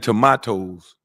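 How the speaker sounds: "tomatoes" said slowly as tuh-MAH-tohz; mocking "tomatoes", middle vowel as in "father"; mocked pronunciation. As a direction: draw out "tomatoes" with a broad "ah". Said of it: "ran up on somebody and say".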